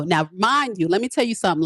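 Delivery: ordinary talk, nothing marked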